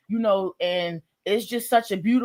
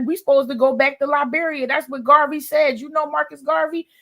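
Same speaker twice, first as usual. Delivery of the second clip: eager Garvey talk; 8 semitones higher than usual. Retold or imitated. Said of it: "I'm like".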